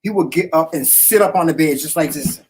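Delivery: ordinary talk, nothing marked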